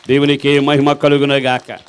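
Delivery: a loud, ringing shout of praise. Real, not acted. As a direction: proclaim it at full voice to the congregation. Praise after healing. (బిగ్గరగా దేవునికి స్తుతి కేక)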